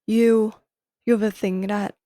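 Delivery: ordinary talk, nothing marked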